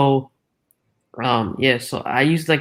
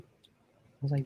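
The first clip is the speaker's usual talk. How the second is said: usual speaking voice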